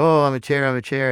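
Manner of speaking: high, loud, hammed-up silly delight; playing along. Speaker plays along with child, high mock excitement. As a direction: play it up for a small child. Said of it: "And I go".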